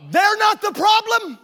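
loud, high, slowed exhortation; full-force preaching. Real, not acted. Preacher pressing the congregation to face their problem, loud. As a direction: drive the point home from the pulpit.

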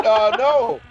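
playing dumb. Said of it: "I tried playing dumb".

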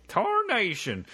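high hammed-up old-timey prospector; squeaky old miner shouting to the boys. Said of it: "old-timey prospectors".

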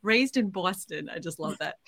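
ordinary talk

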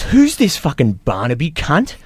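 high, brash quoting of a coworker; hammed-up crude workmate; mock bogan coworker. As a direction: sneer it like the oafish coworker. Coworker's crude remark quoted.

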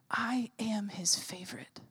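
slow, whispered revelation; hushed whisper. Real, not acted. Preacher revealing what the Lord showed her, in a whisper. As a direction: whisper it slowly like a treasured secret.

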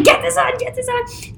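high, rushed, urgent orders; frantic mom. Retold or imitated. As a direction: bark it out while hurrying someone. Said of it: "It was like".

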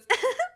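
sudden high burst of laughter; mock surprised laugh; imitated laugh. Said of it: "and, like, laughs".

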